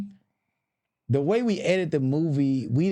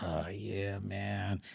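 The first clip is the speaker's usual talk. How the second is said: much softer, lower offhand admission; casual low mumble; 6 semitones lower, 12 dB quieter, much slower than usual. Retold or imitated. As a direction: toss it off under your breath. Eric answering Tiffany casually.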